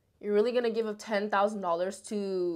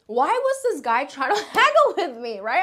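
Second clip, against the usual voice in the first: high-pitched incredulity breaking into a laugh; amused disbelief; 6 semitones higher, 3 dB louder than usual. Real, not acted. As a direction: exclaim it, baffled and laughing. Outraged amusement at his haggling.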